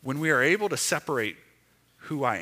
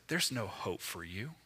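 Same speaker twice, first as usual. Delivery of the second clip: voice of shame; 6 semitones lower, 11 dB quieter than usual. Pretend. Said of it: "The voice of shame says".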